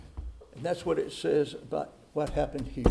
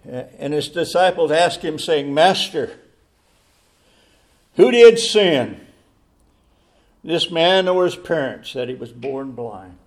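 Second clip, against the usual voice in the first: full-force preaching; 13 dB louder than usual. Acted. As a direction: thunder the disciples' question at the congregation.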